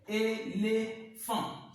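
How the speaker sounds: "éléphant" slowly split into separate syllables. Shown as a demonstration. Teacher cutting a word for pupils, "éléphant" syllable by syllable. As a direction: break "éléphant" into é, lé, phant. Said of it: "les découper simplement par syllabe".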